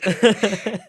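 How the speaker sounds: a real, loud laugh bursting out; loud burst of laughter. Real, not acted. Host laughs at guest's reply.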